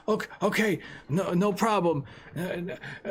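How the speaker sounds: high, panicky, stammering plea; terrified pleading. Acted. Shopkeeper pleading with the gangster, high and terrified. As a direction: beg him to take the money and go. Johnny agreeing to threats.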